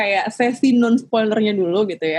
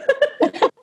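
high embarrassed laugh. Real, not acted. Laughing at her own speech.

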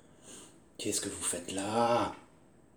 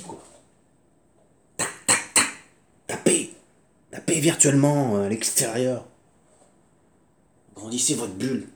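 normal talking voice

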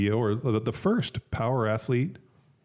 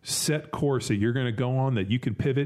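ordinary talk, nothing marked

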